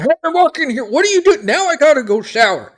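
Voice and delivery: very high, loud outraged townsperson yell; loud, high-pitched indignant outburst; angry yelling. Acted. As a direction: yell at passers-by like a disgusted citizen. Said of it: "you just hear 'em start yelling".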